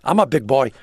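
loud blustery tycoon impression; blustery George Steinbrenner. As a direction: bluster it out like a big-shot team owner. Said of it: "And he said".

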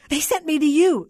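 high, loud, sobbed protest; distraught, crying outburst; sobbing. A narrator's reading. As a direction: wail it tearfully at the clerk. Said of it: "the voice sobbed".

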